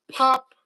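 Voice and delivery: sharp pop sound. Shown as a demonstration. Showing how the muskie broke off, a sudden high pop. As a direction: snap it like a line breaking. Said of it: "he just went like this".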